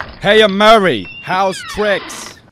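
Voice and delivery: loud hearty greeting. Acted. Dealer arrives greeting Murray.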